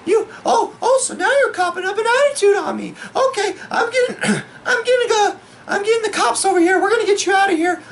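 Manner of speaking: very high falsetto bar-lady voice; hammed-up falsetto scolding; mock angry woman. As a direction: squeal it as an indignant, threatening woman.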